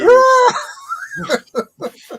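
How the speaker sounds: very high, shrieking burst of laughter; loud laugh. Real, not acted. Prankster breaking up while retelling the confession, shrieking laughter.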